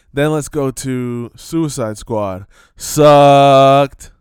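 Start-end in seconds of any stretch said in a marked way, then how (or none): 2.8-4.1 a single blasted word, held long; drawn-out loud sucking verdict. Acted. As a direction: slam the verdict down. Verdict on Suicide Squad.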